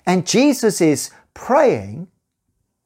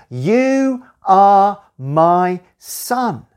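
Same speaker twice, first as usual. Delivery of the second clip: solemn, very slow words from heaven; God the Father speaking, slow and solemn; God's voice, very slow; much slower than usual. Acted. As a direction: let each word land slowly like a blessing.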